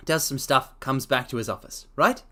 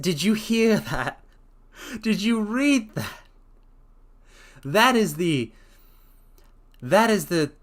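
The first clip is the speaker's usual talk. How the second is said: eager, rising, slowed-down delight; thrilled reader stopping to praise the prose; excited; 7 semitones higher, 4 dB louder than usual. Real, not acted. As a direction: gush about the passage you just read. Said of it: "I'm way too happy about reading this".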